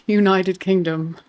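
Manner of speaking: normal talking voice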